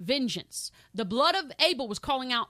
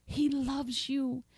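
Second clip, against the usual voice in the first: tender; much slower than usual. Real, not acted. Tender repetition to congregation.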